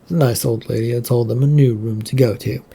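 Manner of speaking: ordinary delivery, nothing marked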